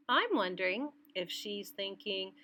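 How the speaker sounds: plain reading voice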